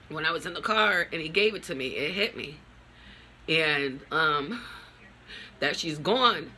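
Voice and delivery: ordinary talk, nothing marked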